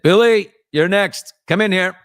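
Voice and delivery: loud, high bellow of a stern dad; stern dad call. Retold or imitated. Father calls his son in for the belt, loud stern bellow. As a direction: summon the kid like a furious father.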